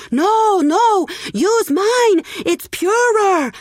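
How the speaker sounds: very high, loud shrieking offer; pushy woman. Acted. Woman shoving past the man to the beggar, shrill eager cry. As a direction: push in and outshout him.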